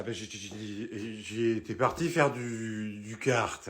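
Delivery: slow, slurring, stumbling words; drunk stammer. Acted. Drunk son fumbling for an excuse, slow and slurred. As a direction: drag it out like you've had too much. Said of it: "t'es bourré".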